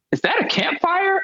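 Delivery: high, innocent question of the clueless friend. Retold or imitated. Friend in the back seat asking innocently, in a high clueless voice. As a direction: ask it way up high and naive.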